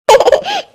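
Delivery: short, high, soft laugh; happy chuckle. Acted. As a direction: let out a relieved little laugh. Relieved, happy mother laughs.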